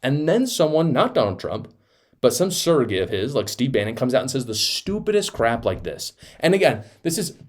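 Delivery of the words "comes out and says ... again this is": ordinary delivery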